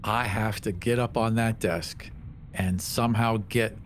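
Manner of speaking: ordinary talk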